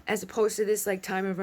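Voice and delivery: normal talking voice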